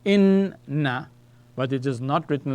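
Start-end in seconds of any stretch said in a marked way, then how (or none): 0.1-1.1 "inna" drawn out as in-na, higher and louder; "inna" in two deliberate syllables, in-na; doubled n demonstration. Shown as a demonstration. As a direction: sound out "inna" with a long, held n. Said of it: "the word is pronounced like this".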